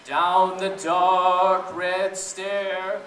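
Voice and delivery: ordinary delivery, nothing marked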